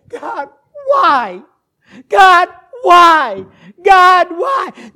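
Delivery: high, loud repeated plea; anguished cry to God. Acted. Preacher voices a suffering believer questioning God, anguished shout. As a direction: shout it up to heaven in desperation. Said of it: "You say".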